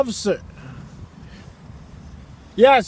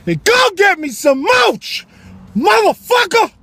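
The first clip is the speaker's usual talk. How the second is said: loud slow yell, much higher; mock wife yelling; 10 semitones higher, 5 dB louder, slower than usual. Wife ordering husband off the couch, shouting. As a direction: bellow it like a furious wife sending him out. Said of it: "And my wife was like".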